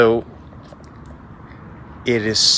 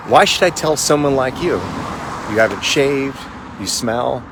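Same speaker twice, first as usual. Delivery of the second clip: higher, louder scornful taunt; monk scorning the samurai's rusty blade, loud; 5 semitones higher, 7 dB louder than usual. Acted. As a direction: look down on him and laugh.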